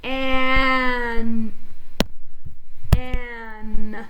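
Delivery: An held long and steady; slow blended An; stretched blend. Shown as a demonstration. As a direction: draw out An with the voice kept on. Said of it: "So here we go".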